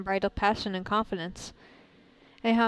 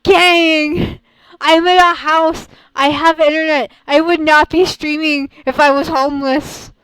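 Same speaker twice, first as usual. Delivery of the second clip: raised, higher ranting defense; loud protest; 6 semitones higher, 14 dB louder than usual. Real, not acted. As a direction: argue back loudly at the chat. Keeps protesting to chat.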